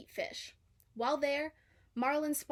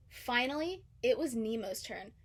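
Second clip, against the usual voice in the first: ordinary delivery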